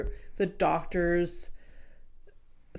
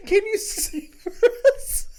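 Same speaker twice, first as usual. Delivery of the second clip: partygoers ask; 13 semitones higher, 5 dB louder than usual. Retold or imitated. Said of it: "a bunch of them are just like".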